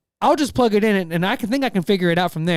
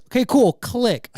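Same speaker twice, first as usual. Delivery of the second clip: mock support agent; 6 semitones higher, slower than usual. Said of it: "I'm gonna not do the accent".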